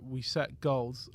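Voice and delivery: slow, low, hushed deadpan; stiff, unfriendly. Retold or imitated. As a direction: imitate a frosty coach, slowly and quietly. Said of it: "she really was quite stiff".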